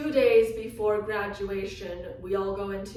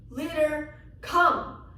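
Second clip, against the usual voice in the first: high, louder call of the name; eager higher summons; calling the dog; 7 semitones higher, 4 dB louder, slower than usual. Retold or imitated. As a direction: call your new dog across the room. Calling her new dog.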